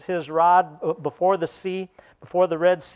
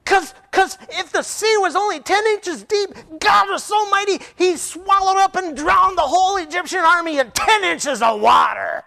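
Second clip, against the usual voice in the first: much higher, louder triumphant dude; excited dude shout; 14 semitones higher, 6 dB louder than usual. Retold or imitated. Kid explaining the miracle to the scholar, triumphant shout. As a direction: shout the punchline with awe. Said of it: "He says".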